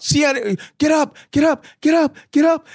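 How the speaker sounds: high, loud urgent yelling; repeated bursts of high-pitched shouting; retold shout. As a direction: shout it down the phone to wake him. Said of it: "all I could do was shout".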